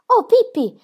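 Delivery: high, loud rapt cry of delight; excited outburst; enraptured cry. A narrator's reading. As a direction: call her name in delight. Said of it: "gridò rapito".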